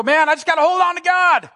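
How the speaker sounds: high, loud mock of someone clinging to God; straining believer. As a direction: ham up a desperate clinging believer.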